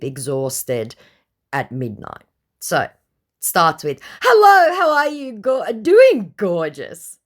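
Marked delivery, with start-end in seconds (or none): 4.2-7.2 very high, loud, sing-song greeting. Retold or imitated. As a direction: ham up a cheesy fake celebrity charmer. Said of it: "says my [name]".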